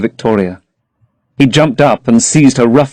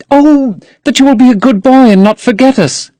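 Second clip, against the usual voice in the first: put-on higher, young girl's plea; lightly raised girlish protest; Sybil crying out; 13 semitones higher than usual. A narrator's reading. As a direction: voice the eager young actress.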